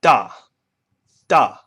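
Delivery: "da" punched and falling sharply. Shown as a demonstration. Teacher demonstrates fourth tone falling da. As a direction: snap "da" down hard. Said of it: "line going down".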